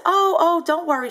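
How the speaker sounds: high, airy dismissive buyer voice; nonchalant buyer. Retold or imitated. Mocking the scammer buyer, breezy and high. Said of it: "She was very nonchalant, lackadaisical".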